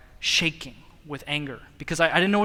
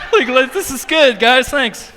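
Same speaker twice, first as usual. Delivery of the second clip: laughing; 7 semitones higher, 8 dB louder than usual. Retold or imitated. Quoting self at awkward retreat.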